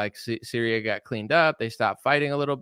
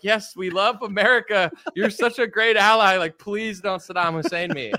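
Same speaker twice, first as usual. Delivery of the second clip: higher, gushing sucking-up voice; mock fawning ally; 8 semitones higher than usual. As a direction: flatter America like a scared ally. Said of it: "that's how countries are like".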